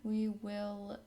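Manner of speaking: ordinary talk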